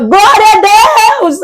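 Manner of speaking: drawn-out, high shout of praise; slow, high cry of Glória a Deus with all her breath; a full-breath shout of praise. Retold or imitated. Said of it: "gritava lá dentro".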